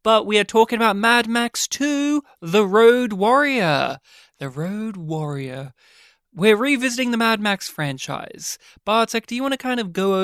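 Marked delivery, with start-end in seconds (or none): 2.4-4.0 slow, drawn-out Road Warrior; title announcement. Pretend. 4.4-5.7 soft, slow repeat of the title; hushed title echo. Pretend. Echoing the announced title.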